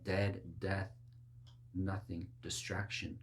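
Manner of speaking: ordinary delivery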